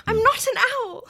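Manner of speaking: high-pitched British indignation; Hermione. Acted. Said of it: "she's, like, screaming about she's not an owl".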